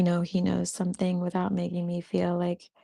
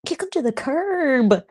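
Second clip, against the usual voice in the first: higher, slow and punchy "kick 'em to the curb"; emphatic; 7 semitones higher than usual. Real, not acted. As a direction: send them packing with gusto. Repeats the phrase.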